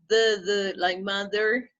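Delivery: slow "the" with a voiced th, no air pushed out; voiced th demo. Shown as a demonstration. Teacher showing students the voiced th, slowly. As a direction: hold the voiced th in "the" and "mother". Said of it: "So you say".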